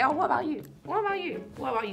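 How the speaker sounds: mock question. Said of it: "you didn't say".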